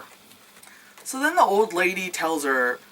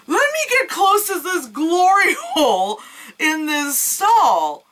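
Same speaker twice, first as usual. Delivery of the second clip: high, loud ditzy voice, breaking into laughs; mocking dumb heroine; 12 semitones higher, 3 dB louder than usual. Mocking the heroine who peers into the hole, high and hammed-up. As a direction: ham up a clueless heroine, high and shouting.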